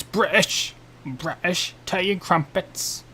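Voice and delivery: hammed-up, badly faked British; terrible British accent. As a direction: put on a silly British accent. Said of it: "putting on my terrible accent".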